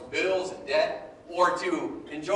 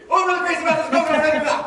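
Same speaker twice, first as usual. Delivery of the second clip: fast talking; 7 semitones higher, 5 dB louder, much faster than usual. Shown as a demonstration. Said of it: "say it as fast as you can".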